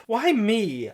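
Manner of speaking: high, plaintive mock fright; mock scared voice. A narrator's reading. Frightened girl asks her captor why, mock scared voice. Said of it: "in a real scared voice".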